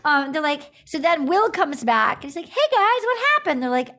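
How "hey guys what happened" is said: high, lightly acted cluelessness; mock innocent question. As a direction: imitate someone walking in unaware, pitched up. Voicing Will returning, unaware.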